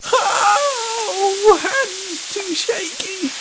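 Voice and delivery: very high, breathless, shaky crying out; a hammy dying gasp; hammed-up panic. Acted collapse, regeneration.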